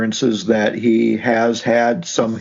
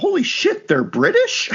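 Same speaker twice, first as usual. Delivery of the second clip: surprised. Retold or imitated. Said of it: "I thought".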